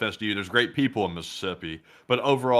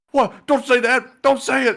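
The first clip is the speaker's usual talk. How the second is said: high, loud, frantic protest; mock defensive panic; 9 dB louder, slower than usual. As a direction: imitate someone leaping to shut down the remark. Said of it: "if you're that defensive about it".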